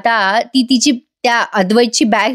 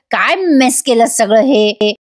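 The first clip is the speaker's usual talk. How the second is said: usual speaking voice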